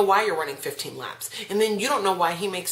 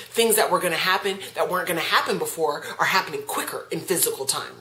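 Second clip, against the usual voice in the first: ordinary delivery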